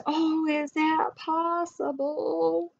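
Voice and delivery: mock surprise. Character reacts in story.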